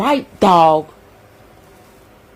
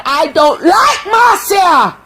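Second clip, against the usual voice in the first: slow indignant shout; shouted dislike; 6 semitones higher, slower than usual. Real, not acted. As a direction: belt it out with disgust. Ranting about Marcia.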